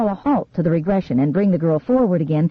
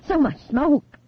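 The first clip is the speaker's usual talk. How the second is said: gagging; 7 semitones higher, slower than usual. A narrator's reading.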